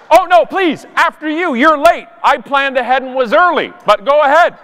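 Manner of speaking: high, sugary fake-polite voice; passive-aggressive politeness. Canadian letting late travellers cut the line, passive-aggressively polite. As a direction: smile through it, sweetly cutting. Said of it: "passive-aggressive politeness".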